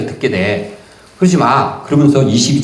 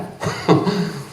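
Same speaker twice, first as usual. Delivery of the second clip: a short real chuckle; a warm little laugh; light laugh; 7 semitones higher, 5 dB quieter than usual. Real, not acted. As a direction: laugh along with the audience. Laughs after asking the audience.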